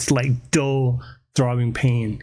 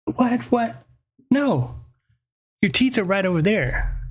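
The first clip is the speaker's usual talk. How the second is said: higher-pitched imitation of a puzzled wife; wife's baffled reply; 7 semitones higher than usual. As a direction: play the wife, high and disbelieving. Voicing his wife's baffled reply.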